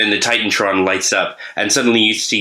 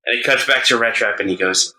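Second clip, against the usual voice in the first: usual speaking voice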